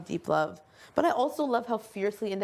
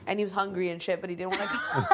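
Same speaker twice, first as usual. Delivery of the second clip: ordinary talk, nothing marked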